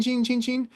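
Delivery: chin said high and sing-song like a bell; ringing bike bell. Retold or imitated. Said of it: "so I was saying chin".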